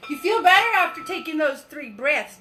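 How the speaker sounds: ordinary talk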